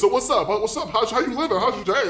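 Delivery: a hint of the chatty driver vouching for his passenger; driver on the phone. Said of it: "He's talking to this person like".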